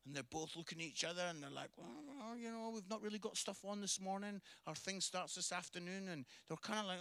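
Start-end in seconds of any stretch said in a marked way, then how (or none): 1.8-6.1 hushed, reluctant mumble; quietly wriggling out of an invitation; hesitant polite excuse. Retold or imitated. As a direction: make a soft, evasive excuse. Ladies politely declining the invitation.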